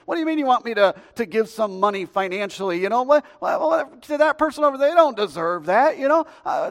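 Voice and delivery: high, loud mock complaining; indignant protest. Preacher plays himself balking at God's command, indignant. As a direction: argue back, incredulous and worked up.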